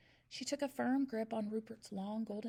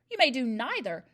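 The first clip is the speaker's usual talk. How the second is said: louder, curt headmistress snap; snapped; 2 semitones higher, 9 dB louder than usual. A narrator's reading. Headmistress refusing a wobbling pupil, snapping. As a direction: cut him off sharply. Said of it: "snapped the Trunchbull".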